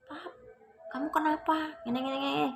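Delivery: mock a wife crying out at normal volume; squeaky-high retold outcry, held back in volume; imitated shouting wife. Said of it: "sang istri teriak".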